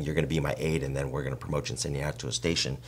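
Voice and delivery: ordinary talk, nothing marked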